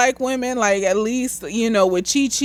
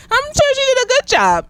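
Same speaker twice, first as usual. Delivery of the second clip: sarcastic; 13 semitones higher, 3 dB louder than usual. Real, not acted. Sarcastic praise of Judy Newmar.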